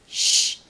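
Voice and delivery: sharp, loud "shh"; urgent shush. A narrator's reading. Christine silencing Raoul in case they are heard, urgent shush. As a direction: hush someone urgently.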